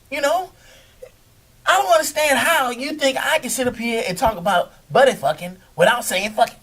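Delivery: ordinary delivery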